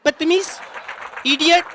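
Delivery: high, loud offended snap; indignant insult. Retold or imitated. Lady snaps at the man who gave her a lighter, shouting loud.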